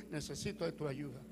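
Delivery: low, soft echo of a prayer; murmured echo. Retold or imitated. Himself copying the old man's prayer, low murmur. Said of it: "Yo digo".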